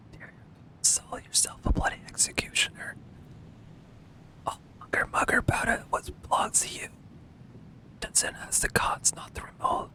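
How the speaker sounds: reading game dialogue in a hushed whisper; mock ASMR whisper of a quest text. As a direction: whisper the lines like a tingles video. Reads NPC lines whispered.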